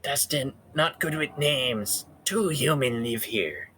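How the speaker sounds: slow high simple critter voice; slow halting little creature; nervous sleepy possum. Pretend. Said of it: "opossum voice".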